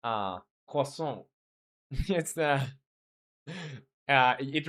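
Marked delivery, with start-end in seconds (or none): 0.7-1.2 croissant said slowly and softly, French-style "k-wa-sson"; slow careful try at a French croissant; attempted French croissant. Shown as a demonstration. Streamer to chat, braving a French croissant, slow and soft. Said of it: "if it's up to embarrassing, let's try it out".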